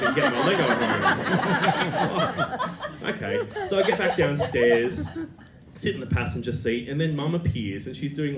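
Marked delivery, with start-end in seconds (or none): 0.0-3.1 laughing. Retold or imitated. Joking despair about being abandoned.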